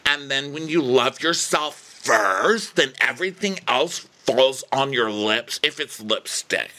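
slow, drawn-out tipsy wisdom; hammed-up rambling sincerity; mock drunken advice. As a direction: stretch it out like a drunk philosopher. Mimicking Emily's drunken self-love speech.

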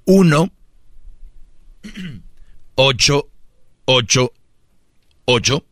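long pauses between each digit; slowly, digit by digit. Shown as a demonstration. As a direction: dictate each number slowly. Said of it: "voy a dar el número despacito".

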